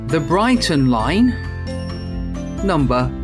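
sing-song bingo number calls; bingo caller. Acted. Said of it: "eyes down, here's the answer".

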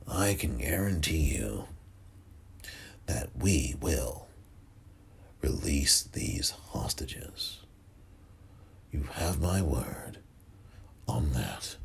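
hushed, slow, low presidential impression; a pathetic imitation of a president, softly drawn out with long pauses; Ronald Reagan. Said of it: "That's the most pathetic Ronald Reagan accent. Impression".

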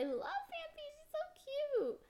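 very high squeal over something cute; gushing. Real, not acted. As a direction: squeal with cute-overload delight.

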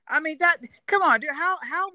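exasperated outburst. Real, not acted. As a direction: blurt it out in disbelief. Outraged at the quoted remark.

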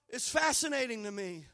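ordinary talk

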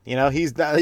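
ordinary talk, nothing marked

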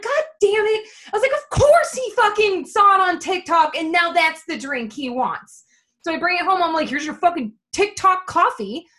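high, loud mock fury; retold exasperation. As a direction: vent like a fed-up wife. Retold annoyance at husband's drink.

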